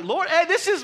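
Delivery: high, loud protest at the Lord; retold shout. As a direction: yell it up at the sky, high and loud. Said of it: "I actually shouted".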